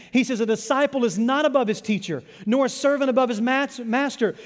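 loud, high-pitched sermon delivery; full-force preaching. Real, not acted. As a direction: preach it hard, driving each phrase.

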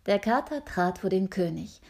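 usual speaking voice

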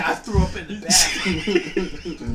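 slow, laughing quote of a queasy coworker; mock sick coworker. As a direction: drag it out through laughter.

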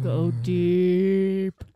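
low and loud; deep voice. Shown as a demonstration. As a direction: push the voice way down. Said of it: "How deep can you go?".

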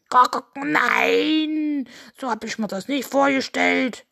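clucking, then a long wailed no; high, loud rooster complaint; dismayed rooster. Acted. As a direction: protest as a let-down cartoon rooster. Rooster rejects the eggs.

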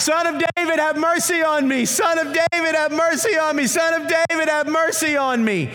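high, loud pleading cry over and over; repeated desperate shout. Acted. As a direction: keep crying out so Jesus hears. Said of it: "he continued to shout".

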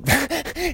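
sudden high delighted laugh; high giggle; burst of high laughter. Real, not acted. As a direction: laugh out at the surprise. Mail guy tossing paper.

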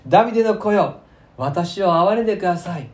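loud, high call for mercy; fierce acted plea. Blind beggar calling over the crowd that hushes him, fierce plea. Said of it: "その人はますます激しく".

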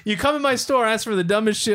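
ordinary talk, nothing marked

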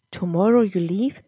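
plain reading voice

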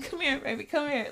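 high-pitched, coaxing mock plea; playful impression of a sitcom boyfriend begging; Martin. Her begging partner back from his walk, mock coaxing. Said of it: "You know that little Martin?".

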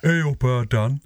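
imitated Yorkshire phone greeting; Leeds accent. Brother answering the phone, imitated Leeds accent. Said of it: "he's developed a Leeds accent".

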